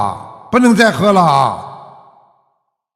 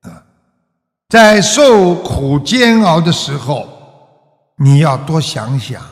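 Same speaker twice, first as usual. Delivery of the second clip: normal talking voice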